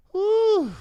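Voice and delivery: high, joyful whoop; relieved whoop. Real, not acted. Host finding the right photo, a high relieved whoop. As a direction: let out a glad cry of relief. Finally finds picture.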